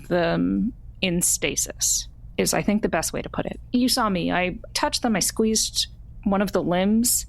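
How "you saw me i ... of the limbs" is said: ordinary talk, nothing marked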